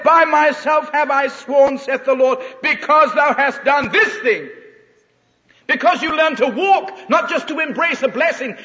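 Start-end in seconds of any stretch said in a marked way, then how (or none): 0.0-4.5 slow, loud, ringing "By myself have I sworn"; proclaimed loudly. Shown as a demonstration. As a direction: proclaim it like God's oath. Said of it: "God said".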